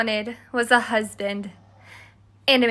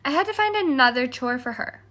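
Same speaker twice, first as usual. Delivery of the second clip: usual speaking voice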